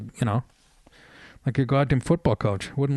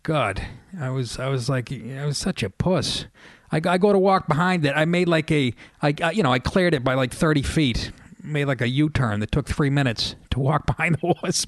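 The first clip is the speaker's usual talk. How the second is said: normal talking voice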